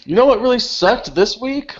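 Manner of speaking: a cheesy ad read, sponsors mocked; mock sponsor pitch. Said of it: "we'll just be like".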